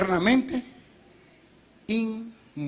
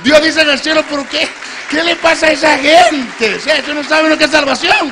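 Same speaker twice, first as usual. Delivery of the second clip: loud, high preaching, full force; 9 semitones higher, 13 dB louder than usual. Acted. Preacher pleading with the congregation at full force. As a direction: hammer it home to the congregation.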